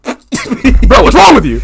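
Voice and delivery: high, loud mock dad; dad's voice. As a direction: play the baffled dad, way up high. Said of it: "And then he's like".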